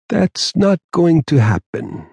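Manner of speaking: slow, certain and unhurried; as if he already knows the future; confident. A narrator's reading. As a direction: Don calmly assuring the detectives. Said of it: "with the confidence of a mystic".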